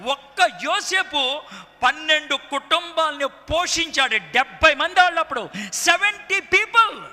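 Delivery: building high and loud to a climax; impassioned preaching. Real, not acted. Preacher listing all whom Joseph fed, impassioned crescendo.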